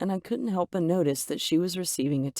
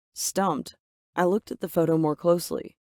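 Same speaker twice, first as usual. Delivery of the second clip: ordinary delivery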